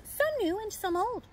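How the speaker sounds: plain reading voice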